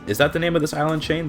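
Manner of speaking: normal talking voice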